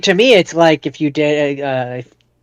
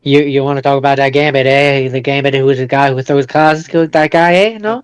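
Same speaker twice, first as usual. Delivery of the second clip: usual speaking voice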